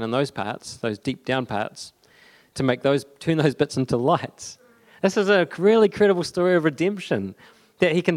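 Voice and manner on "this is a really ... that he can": excited. Real, not acted. Preacher rises on good news.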